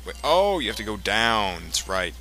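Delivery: ordinary talk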